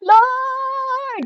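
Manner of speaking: high, drawn-out cry to the Lord. Retold or imitated. A troubled believer crying out to God, high desperate call. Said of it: "sumisigaw na siya ng ganun".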